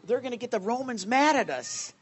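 lightly voiced Pharisees telling Jesus to shut the crowd up; mock alarmed Pharisees. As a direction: scold like a nervous Pharisee hushing the crowd. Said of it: "it said to Jesus".

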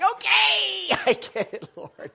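high, loud exasperated "Okay, I get it, Lord"; mock surrender with a laugh. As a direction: throw up your hands and give in. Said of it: "And I'm like".

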